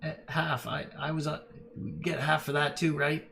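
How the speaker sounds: high, greedy goblin chiming in; pushy little goblin wanting his cut. Acted. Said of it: "And the goblin goes".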